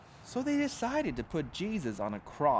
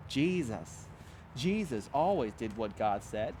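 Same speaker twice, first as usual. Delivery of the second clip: ordinary delivery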